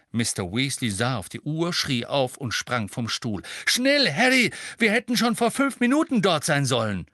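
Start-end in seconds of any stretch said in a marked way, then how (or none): 3.6-7.1 much higher and louder, hurried call; urgent shout. A narrator's reading. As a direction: yell it while running for the door. Urgent call while rushing out.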